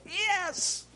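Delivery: high, thin exultant cry of angels; angels cheering. Retold or imitated. Preacher voicing angels cheering, high exultant cry.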